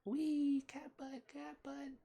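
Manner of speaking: very high, silly squeal. Real, not acted. Owner playfully squealing at her cat, very high.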